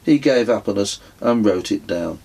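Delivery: ordinary delivery, nothing marked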